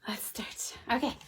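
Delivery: whispered aside to herself; hushed little start. Real, not acted. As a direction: breathe it quietly. Whispered aside before reading.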